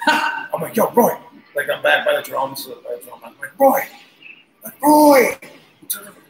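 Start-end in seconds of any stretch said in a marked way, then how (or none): 0.8-1.2 retold call to the drummer, raised and higher. On stage, calling the drummer over the music: raised call. 3.6-5.4 high, loud shout of a name. Retold or imitated. On loud stage, shouting to the drummer: high loud yell.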